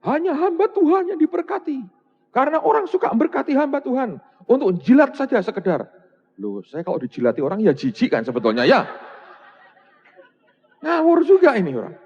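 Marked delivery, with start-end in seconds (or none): 0.1-5.9 mock indignant accusation. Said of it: "Dia marah, dia berkata".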